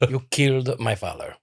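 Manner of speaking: repeated swordsman catchphrase, hushed and sinking low; playful quiet impression of a film avenger; Inigo Montoya. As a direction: drop softly into a mock-solemn movie vow. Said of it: "keep saying it over and over".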